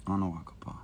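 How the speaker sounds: whispered reassurance; soft unvoiced comfort. Real, not acted. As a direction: breathe it softly like comforting a friend. Soft consolation to a fan.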